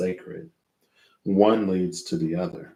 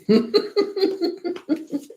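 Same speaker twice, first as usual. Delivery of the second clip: high laugh. Real, not acted. Laughs after friend memory.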